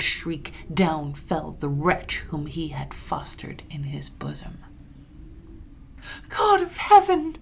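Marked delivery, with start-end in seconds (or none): 6.3-7.4 very high, loud cry to heaven; loud, high exclamation of shock; horrified cry. A narrator's reading. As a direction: cry out in horror. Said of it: "cried my father".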